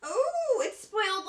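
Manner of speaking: very high ooh, then teary; thrilled recognition of the brand; delighted squeal. Real, not acted. Vlogger recognizing a brand in her gift box, high squeal.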